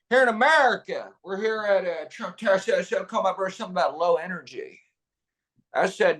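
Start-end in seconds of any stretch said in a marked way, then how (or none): 0.1-4.8 a hint of Trump's rally cadence; Trump impression. Comic doing Trump on camera, lightly voiced. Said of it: "I do a little George W. Bush".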